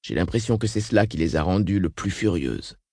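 ordinary delivery, nothing marked

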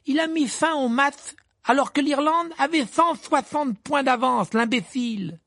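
high, exasperated yelling; shouting. A narrator's reading. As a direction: yell in disbelief at a foolish move. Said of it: "cria Ron".

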